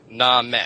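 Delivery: "Name" as "nahm" with a short open vowel; old pronunciation. Shown as a demonstration. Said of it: "The E is that schwa".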